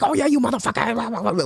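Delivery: high-pitched, hammed-up New York accent; badly faked New Yorker cursing someone out; botched New Yorker. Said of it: "that was the wrong accent".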